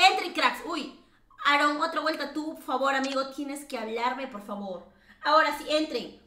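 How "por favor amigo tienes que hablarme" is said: ordinary delivery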